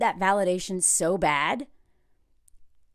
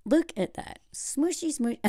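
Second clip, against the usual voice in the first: cooing; 4 semitones higher, 6 dB quieter than usual. Real, not acted. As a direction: coo at a cute face. Cooing at a face.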